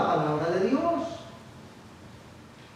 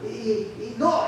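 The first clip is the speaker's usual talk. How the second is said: ordinary delivery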